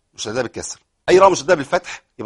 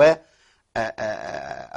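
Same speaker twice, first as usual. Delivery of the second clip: ordinary talk, nothing marked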